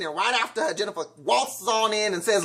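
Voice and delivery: usual speaking voice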